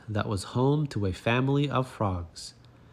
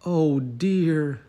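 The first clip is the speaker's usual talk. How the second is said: higher, louder sigh of dismay; dismayed animals; 5 semitones higher, 4 dB louder than usual. Acted. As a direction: animals realising they ignored the pig, dismayed. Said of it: "said the animals".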